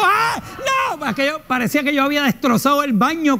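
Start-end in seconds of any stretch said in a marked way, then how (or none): 0.0-1.1 high yelping cries of fright; scared yelling. Retold or imitated. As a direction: yelp in panic. Retold screaming in bathroom.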